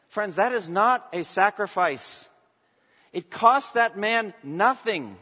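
pastor condemning the costless gift to his congregation, full-force preaching. Acted. Pastor denouncing the gift with no sacrifice.

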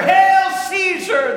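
slow, loud, high roar of a crowd hailing the emperor; crowd shout. Retold or imitated. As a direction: bellow it out like a stadium crowd. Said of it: "the crowd shouted".

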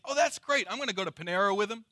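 breezy, fast, easygoing chatter; casual delight. Acted. Churchgoer imagines a relaxed coffee with Jesus, breezy and pleased. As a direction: rattle it off lightly, as if planning coffee. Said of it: "we'd hear that and we think".

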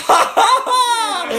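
high, loud gloating "ha ha ha"; exaggerated victorious laugh; triumphant mock cackle. Preacher after the second healing, gloating cackle. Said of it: "the boldness returns".

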